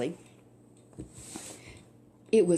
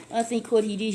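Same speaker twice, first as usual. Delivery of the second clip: ordinary delivery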